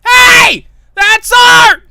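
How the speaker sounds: very high, all-out shout; hysterical screaming. Acted. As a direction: scream after someone getting away. Pauline screams after plane.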